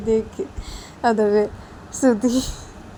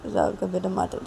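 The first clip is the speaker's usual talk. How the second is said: ordinary delivery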